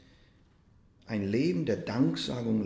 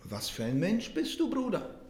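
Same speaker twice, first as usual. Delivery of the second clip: rising higher in wonder toward Bruder; astonished question; 8 semitones higher than usual. Retold or imitated. Monk Tauler asks the beggar in amazement. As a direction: marvel at the beggar as you ask. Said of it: "ganz erstaunt und er fragte".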